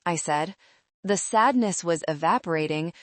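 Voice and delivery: plain reading voice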